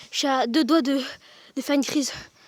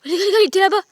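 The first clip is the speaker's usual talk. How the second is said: high terrified yelling while running; panicked screaming; 8 semitones higher, 4 dB louder than usual. Real, not acted. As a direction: scream it as if being chased. Fleeing chased, screaming. (hurler de panique en fuyant)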